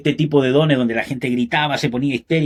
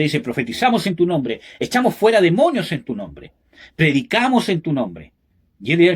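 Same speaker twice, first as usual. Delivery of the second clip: raised, higher preaching voice pressing the point; fiery preaching; 5 semitones higher than usual. Acted. Preacher warns his congregation about false spirits, fiery and raised. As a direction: preach it hard, calling the congregation out.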